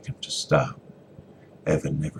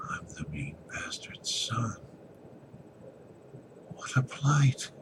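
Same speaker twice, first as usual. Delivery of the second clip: soft, slow whisper of despair; 7 semitones higher, 5 dB quieter, slower than usual. Acted. Evan alone in the closet, whispered despair. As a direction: murmur it to yourself, hopeless.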